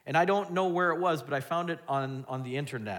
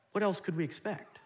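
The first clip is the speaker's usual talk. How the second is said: ordinary talk, nothing marked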